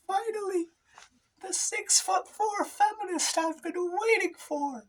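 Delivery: very high, slow dreamy swoon; very high adoring voice greeting the hero. Acted. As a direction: swoon up high like a smitten fan.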